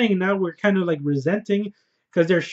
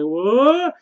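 shocked what; 6 semitones higher, 4 dB louder than usual. Retold or imitated. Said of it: "And she's like".

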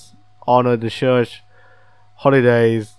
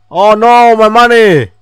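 higher and louder wail of dismay; dismayed cry; 9 semitones higher, 9 dB louder than usual. Real, not acted. Player losing all the money, loud high wail. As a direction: cry out over the lost money.

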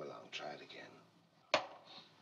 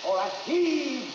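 high, loud, drawn-out heave call; stretched working shout; shouted command; 8 semitones higher, 6 dB louder, much slower than usual. Real, not acted. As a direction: call out the heave slowly and loudly. Director calling the effort on set.

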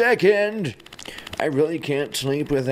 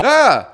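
loud sharp cry of pain; pained yell; 10 semitones higher, 10 dB louder than usual. Acted. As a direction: yelp out in pain. Character hurt, ow ow.

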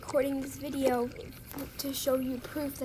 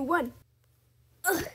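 ordinary talk

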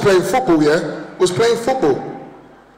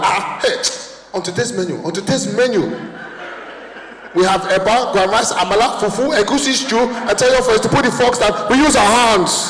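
Nigerian mum; 4 semitones higher than usual. Retold or imitated. Nigerian mum listing dishes to the kids, proud accent. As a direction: announce the menu like a proud African mother. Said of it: "My mum be like".

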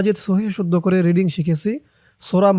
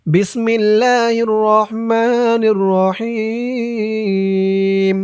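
slow, higher chanted recitation; Quran recitation; 5 semitones higher, much slower than usual. Shown as a demonstration. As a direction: intone it slowly like tajweed. Recited basmala.

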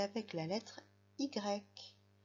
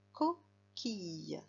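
slow, drawn-out word; much slower than usual. Shown as a demonstration. Teacher to young pupil, modelling the word slowly. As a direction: stretch coquille out slowly, syllable by syllable. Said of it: "Alors, le premier mot, c'est une".

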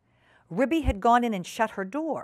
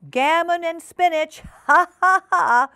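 high, loud crowing laugh of a magpie; triumphant shout; 8 semitones higher, 8 dB louder, slower than usual. Acted. Doctor Maggotty crows loudly at the back door in triumph. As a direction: crow it out in triumph. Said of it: "shouted Doctor Maggotty triumphantly".

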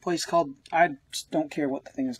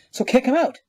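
barked order in a high, loud put-on voice; commanding alien captain; 8 semitones higher, 4 dB louder than usual. Reviewer voicing the alien captain ordering the prisoners out, commanding. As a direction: play the captain ordering them thrown off, louder and higher.

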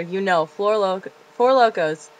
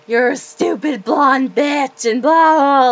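put-on loud, slow drunken snarl; drunk insult; 6 semitones higher, 7 dB louder, slower than usual. Cussing out her friends in the car, slow loud drunk snarl. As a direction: spit it at your friends. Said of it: "I'm like".

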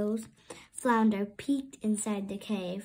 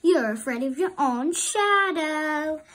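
higher, louder mocking tease; teasing sister; 5 semitones higher, 6 dB louder than usual. A narrator's reading. Sister mocking scared brother, higher teasing. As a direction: rub it in playfully. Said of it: "she teased him".

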